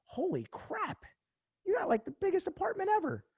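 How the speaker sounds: put-on gawk at the size like a kid seeing his first house; wide-eyed astonishment; amazed kid. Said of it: "I'm like".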